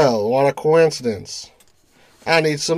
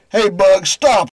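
shouting; 6 semitones higher, 3 dB louder, slower than usual. Acted. Calling out to stop shooter.

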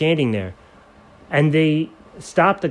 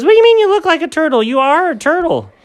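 very high, fast and loud disbelief; high squeaky outrage; incredulous friend; 5 dB louder, faster than usual. Acted.

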